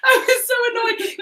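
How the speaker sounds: high, slow, breaking into laughter; laugh-crying through it; giggling over her annoyance. Real, not acted. Laughing at her own story.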